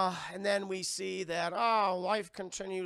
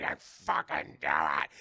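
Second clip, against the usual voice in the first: low, slow menacing voice; low slow growl; mock villain growl; 12 semitones lower, 5 dB louder, slower than usual. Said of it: "He's like".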